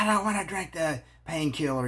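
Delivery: hammed-up aggravated protest; mock aggravated whine. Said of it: "get all aggravated and go".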